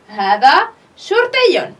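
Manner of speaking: هذا شرطي spoken very slowly, شرطي falling at the end; slow model answer. Shown as a demonstration. Teacher giving pupils the final answer, very slowly. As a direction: spell the answer out at half speed. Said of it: "اسم الإشارة هذا".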